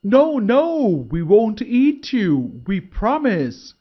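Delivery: high, loud chorus of eager crocodiles; crocodiles promising. A narrator's reading. Crocodiles assuring the deer they won't eat him, high eager voices. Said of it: "said the crocodiles together".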